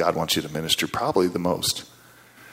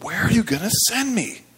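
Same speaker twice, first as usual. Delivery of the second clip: high, near-shouted plea to God; yelling prayer; 13 semitones higher than usual. Retold or imitated. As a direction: cry out the question in frustration. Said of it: "asking almost in, like, a yelling voice".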